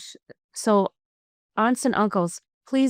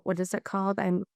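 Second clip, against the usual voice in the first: ordinary talk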